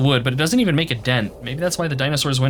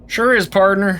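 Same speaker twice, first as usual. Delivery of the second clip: put-on higher whooping old-west yokel; hammed-up cowboy; 5 semitones higher, 4 dB louder than usual. Voicing cowboy Gabby bragging about his draw, hammed-up old-west twang. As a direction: holler it like a cartoon cowpoke. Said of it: "Gabby doesn't talk like that".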